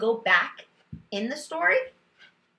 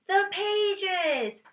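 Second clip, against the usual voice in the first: put-on child's answer; 10 semitones higher than usual. Teacher voicing child's answer.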